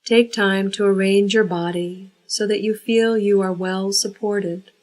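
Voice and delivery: ordinary delivery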